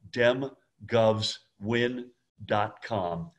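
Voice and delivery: dem govs win dot com said very slowly, each word apart; slow it right down so they can write it; slow, spelled-out repeat. Shown as a demonstration. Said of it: "make sure you make note of this".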